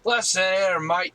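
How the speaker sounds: loud, high mock Aussie-Scottish mix; mixed accents. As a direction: bark it like a cartoon bloke. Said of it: "mixing a whole bunch of accents".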